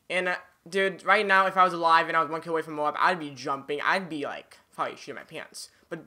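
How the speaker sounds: usual speaking voice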